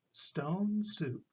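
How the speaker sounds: ordinary delivery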